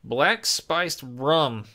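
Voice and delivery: slow, drawn-out announcement; dramatic reveal. Real, not acted. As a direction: unveil it slowly like a reveal.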